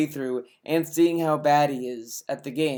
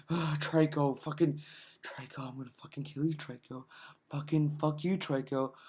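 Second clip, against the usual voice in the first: much softer, low seething; mock seething murmur; 3 semitones lower, 11 dB quieter than usual. Mocking Phil cursing his game companion, soft seething. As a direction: hiss the threats under your breath. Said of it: "said, like".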